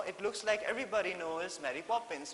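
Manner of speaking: ordinary delivery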